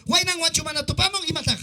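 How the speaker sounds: ordinary delivery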